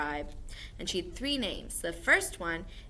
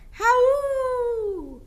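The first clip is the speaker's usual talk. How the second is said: high, loud falling howl; wolf howl; 10 dB louder than usual. Shown as a demonstration. Teacher shows the class a wolf howl. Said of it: "Wolves howl".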